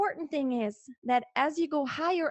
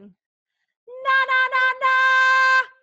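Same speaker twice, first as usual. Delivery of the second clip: very high, loud, strained na; just shout the notes; pushed yelling na; 12 semitones higher, 9 dB louder, much slower than usual. Shown as a demonstration. Coach showing the wrong way, yelling na very high and loud. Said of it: "instead of just going".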